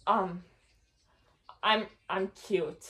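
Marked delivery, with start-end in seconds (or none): none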